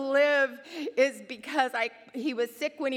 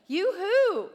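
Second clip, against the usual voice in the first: playful call; 8 semitones higher, 6 dB louder than usual. Real, not acted. Calling out to mamas.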